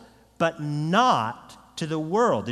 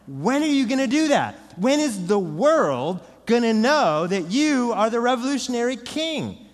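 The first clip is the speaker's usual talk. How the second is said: impatient Judas; 6 semitones higher, 4 dB louder than usual. Retold or imitated. Judas voiced demanding Jesus act.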